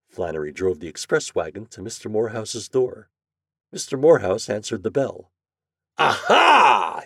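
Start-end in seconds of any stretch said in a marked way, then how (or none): 6.0-7.0 loud, high, drawn-out exclamation; triumphant cry. A narrator's reading. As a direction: cry out as if catching him at last. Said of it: "he cried".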